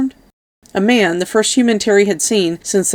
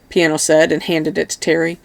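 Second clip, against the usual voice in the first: plain reading voice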